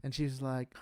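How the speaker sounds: ordinary delivery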